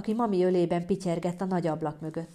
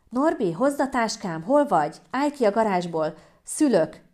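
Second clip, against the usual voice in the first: raised, urgent calling out; urgent shouting; 4 semitones higher, 5 dB louder than usual. Acted. Woman in labour calling her partner, urgent shout. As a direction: call for help through the house. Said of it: "kiabáltam".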